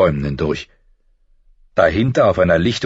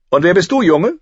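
high, loud imperious question; commanding tone; 11 semitones higher, 5 dB louder than usual. A narrator's reading. Prince questioning the older boy, commanding tone. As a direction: demand an answer like a haughty prince. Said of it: "fragte er in einem Befehlston".

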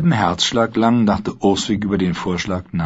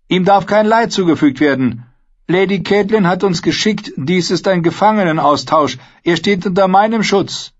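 higher, insistent woman's voice; firm protest; 11 semitones higher, 4 dB louder than usual. A narrator's reading. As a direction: hold your ground and protest. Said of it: "den Protest des Mädels".

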